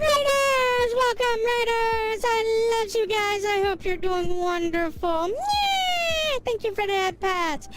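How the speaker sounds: high, drawn-out cheer; excited raid greeting. Real, not acted. Streamer welcoming raiders, excited high cheer. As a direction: welcome them with long, ringing high vowels.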